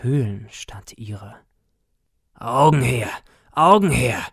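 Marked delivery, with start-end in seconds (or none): 2.3-3.1 "Augen her" boomed out loud, falling at the end; a dull, booming demand for the eyes; booming call. A narrator's reading. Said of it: "mit dumpfer, dröhnender Stimme". 3.5-4.2 "Augen her" even louder and higher, a roaring repeat; a harder second demand for the eyes; louder booming call. A narrator's reading. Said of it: "mit dumpfer, dröhnender Stimme".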